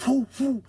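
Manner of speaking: high, light whoops; mild whooping. Retold or imitated. Trucker mimics passing rigs with light whoops.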